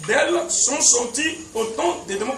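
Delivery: ordinary talk, nothing marked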